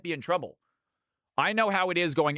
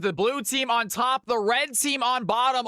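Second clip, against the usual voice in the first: hyped announcer call; 8 semitones higher than usual. Acted. Team call, raised pitch.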